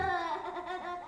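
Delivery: high, eerie wind-borne laugh; a cruel spirit laughing far off; eerie distant laugh. Acted. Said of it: "The voice laughed more loudly in the wind".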